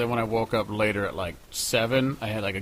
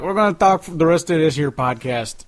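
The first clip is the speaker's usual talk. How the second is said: loud, higher mock Chicago accent, spoken with a grin; hammed-up Chicago accent; 7 semitones higher, 10 dB louder than usual. Cohost jokes about talking Chicago: hammed-up Chicago accent. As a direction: play up a Chicago accent for the listeners. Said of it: "with the Chicago accent".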